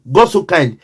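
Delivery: usual speaking voice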